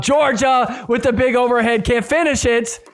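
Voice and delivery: ordinary delivery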